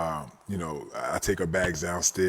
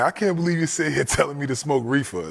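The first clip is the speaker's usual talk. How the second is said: laughing grandma voice; 7 semitones higher, 4 dB louder than usual. Retold or imitated. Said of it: "she's laughing".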